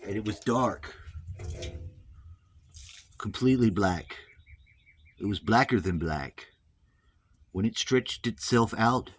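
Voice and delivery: put-on solemn storybook narration, American accent; storyteller voice. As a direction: spin an ancient myth, American accent. Mock-solemn creation tale.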